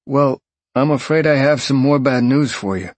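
concerned detective on the phone; gruff detective. Acted. Detective voiced.

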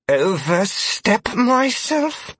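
high, slow, seething disbelief; seething through clenched teeth. A narrator's reading. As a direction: spit it out through gritted teeth. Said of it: "seethed in between clenched teeth".

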